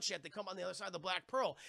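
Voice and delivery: ordinary delivery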